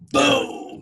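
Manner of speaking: excited. Real, not acted. Punchline after reading breakup quote.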